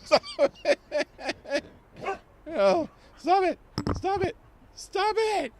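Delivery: half-laughing stop it; laughing protest. Real, not acted.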